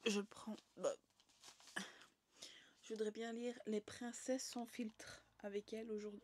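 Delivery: whispering. Real, not acted. Whispering near child falling asleep.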